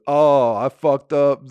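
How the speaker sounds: loud, drawn-out mock dread; retold dismay. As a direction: wail it as if you're caught. Retells panicked immediate thought.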